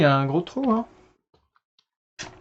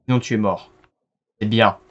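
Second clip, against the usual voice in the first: domineering master; 3 semitones lower than usual. Pretend. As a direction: lower voiced mock tyrant. Plays master to a gollum.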